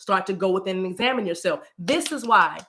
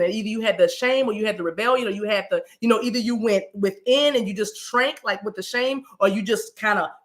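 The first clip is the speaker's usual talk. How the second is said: normal talking voice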